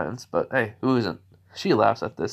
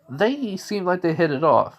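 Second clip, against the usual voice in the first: ordinary talk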